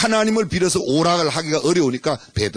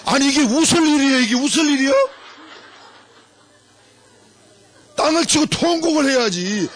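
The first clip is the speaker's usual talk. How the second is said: mock scolding; 9 semitones higher than usual.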